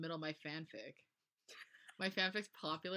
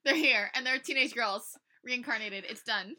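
loud, high mock alarm; mock-excited announcement; 6 semitones higher, 11 dB louder than usual. Friend announces Metallica reborn as teenage girls, loud mock excitement. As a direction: proclaim it at full volume as a joke.

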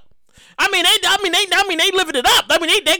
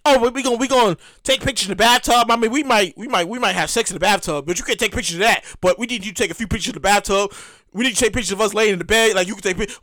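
voiced couple ordering a photographer; 6 semitones lower, faster than usual. Couple to their hired photographer, low casual instructions. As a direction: lightly play the couple giving the photographer orders. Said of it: "You get a photographer, like".

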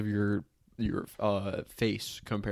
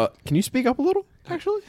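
much higher, fast and loud; mock girly voice; 7 dB louder, faster than usual. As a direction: squeak it like a fussy sound check. Mocking ex checking recording levels.

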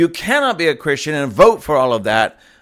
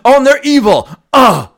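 much higher, louder hammed-up preaching; mock preacher shout; 10 semitones higher, 9 dB louder than usual. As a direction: cry it out like a revival preacher. Parodied preacher shout, then repeated calmly.

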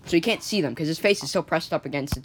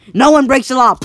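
much higher and louder, barking the rule; threatening shout; 8 semitones higher, 11 dB louder than usual. Acted. Hooded gang shouts the law at Jonathan, loud and high. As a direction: yell it like an enforcer.

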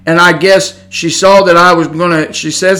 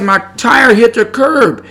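high, slow, stretched-out complaint; retold yelling; 6 semitones higher, slower than usual. Woman complaining to him about her tire, mock yell. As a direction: mimic an upset driver, pitched up. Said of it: "she was yelling at me".

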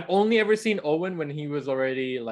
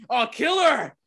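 mock gleeful shout of kill her; egging on a death with mock glee; imitating a sister cheering for a death; 9 semitones higher than usual. Sister's imagined cheer in retelling.

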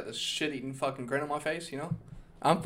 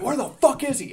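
angry yell; 11 semitones higher, 7 dB louder than usual. Retold or imitated. Friend hears about the punch.

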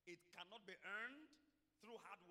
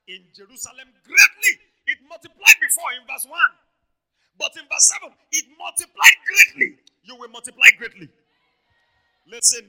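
loud, raised-pitch prophetic declaration; full-force preaching; 7 semitones higher, 42 dB louder than usual. Real, not acted. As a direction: shout each blessing over the congregation.

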